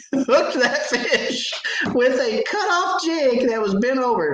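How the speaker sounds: laughing through the words, high and slow. Real, not acted. Father laughing in disbelief at his son's impossible catch. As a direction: crack up in disbelief while telling it.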